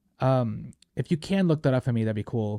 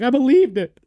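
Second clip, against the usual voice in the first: higher and louder, a choked-up squeal; mock tearful boyfriend; 10 semitones higher, 7 dB louder than usual. Pretend Tom Holland gushing over her love scene, higher and louder. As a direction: gush it like he's about to cry. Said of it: "he's like".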